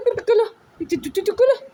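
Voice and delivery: put-on squeaky high cooing to the baby; high baby talk. Mother to her baby girl, squeaky high cooing. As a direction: coo up high and sing-song.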